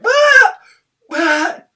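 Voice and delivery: loud high yelling cry; parent's exasperated shriek; frustrated outburst. Retold or imitated. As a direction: blow up with a wordless shout. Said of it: "I was like".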